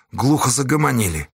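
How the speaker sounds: plain reading voice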